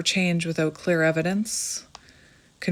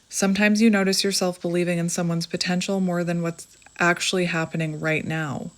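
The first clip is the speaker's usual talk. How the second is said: ordinary talk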